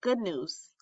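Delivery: plain reading voice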